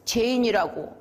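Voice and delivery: ordinary delivery, nothing marked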